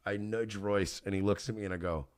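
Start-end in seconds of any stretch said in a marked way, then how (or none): none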